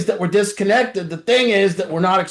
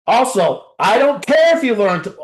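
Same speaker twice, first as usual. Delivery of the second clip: ordinary delivery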